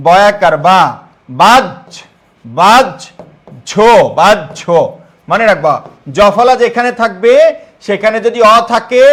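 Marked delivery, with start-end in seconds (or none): 0.6-4.8 "বাজ, ঝো" split into baj and jho; drawn-out "বাজ", pause, then aspirated "ঝো"; syllable-by-syllable pronunciation. Shown as a demonstration. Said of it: "তাহলে কি হবে?".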